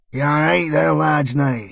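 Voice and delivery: a friendly Northern Irish greeting to bouncers; Northern Irish accent. Acted. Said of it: "out came Ian Paisley on acid".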